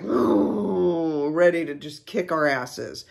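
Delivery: imitated menacing bear sound; mama bear noise. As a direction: voice a bear ready to attack. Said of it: "Mama Bear is like".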